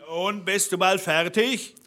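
lightly acted impatient shout; calling up from below. A narrator's reading. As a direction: call upstairs as the waiting villain. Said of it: "Da rief der Blaubart unten".

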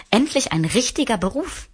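put-on light, boyish delight; little prince. A narrator's reading.

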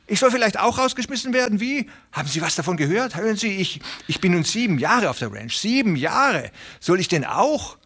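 high, fast, fretful little tenant pleading; a nervous little farmhand begging not to lose his place; Hooven the anxious tenant. Acted. Little tenant farmer pleading with Presley, high and anxious.

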